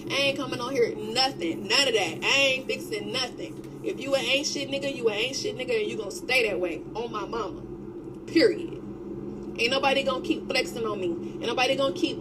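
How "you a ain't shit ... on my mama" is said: ordinary talk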